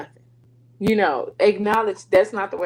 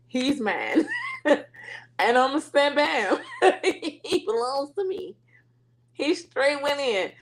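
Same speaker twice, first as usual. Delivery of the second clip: higher-pitched, laughing through the words; amused, laughing; 6 semitones higher than usual. Real, not acted. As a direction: claim him through bubbling laughter. Laughing as she claims her son.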